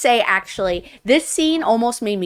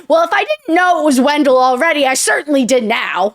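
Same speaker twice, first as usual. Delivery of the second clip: higher, louder retold retort; emphatic knowing quote; 4 semitones higher, 5 dB louder than usual. She recognises Wendell from his bossy words, emphatic. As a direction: voice her emphatic, knowing reaction. Retelling her knowing reaction.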